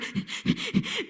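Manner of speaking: quick, shallow, voiceless puffs; rapid panicky breathing. Shown as a demonstration. Trainer imitating a child too worked up to breathe, panting. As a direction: hyperventilate in short bursts. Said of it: "when they're".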